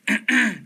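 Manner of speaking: sharp high throat clearing; loud throat clear. Real, not acted. Mid word list, loudly clears throat. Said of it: "clears throat loudly".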